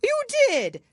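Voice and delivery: high, loud indignant retort; shrill insistence. Acted. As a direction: snap it back high. Hatter insists.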